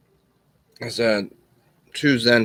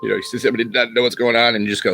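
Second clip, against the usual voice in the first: ordinary delivery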